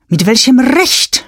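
high, loud indignant cry. Acted. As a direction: fling the question back in outrage. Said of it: "schrie ich". (empörter Aufschrei, hoch und laut)

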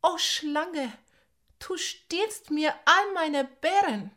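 high, loud indignant call. Acted. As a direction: call out to the thief. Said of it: "rief Nandi".

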